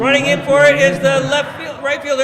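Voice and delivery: high, loud play-by-play shout; excited. Real, not acted. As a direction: call the fly ball with excitement. Live call of misplay.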